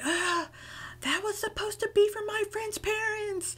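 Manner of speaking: high, distressed complaint; upset yell. Retold or imitated. To brother about the eaten chocolates, high upset complaint. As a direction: wail that the gift is ruined. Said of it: "I don't know if I was yelling".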